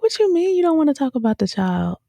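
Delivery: very high, stunned question; incredulous. Retold or imitated. Fan yelling at Tatiana on TV, shocked high question. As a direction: demand it in disbelief. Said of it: "I was like".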